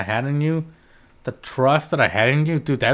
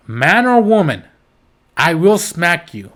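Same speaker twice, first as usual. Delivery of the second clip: loud emphatic threat; 4 semitones higher, 5 dB louder than usual. Real, not acted. Heated threat to child hitters.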